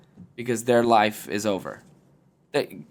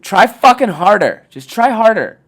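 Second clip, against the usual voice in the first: loud, higher coach yell; rally them like a coach; loud, high push to try harder at sleeping; fired-up pep talk; 6 semitones higher, 10 dB louder than usual. Real, not acted.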